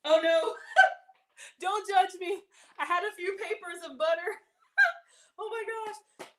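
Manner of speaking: laughing through the words, embarrassed. Real, not acted. Laughing at herself over melted butter. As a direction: giggle it out sheepishly.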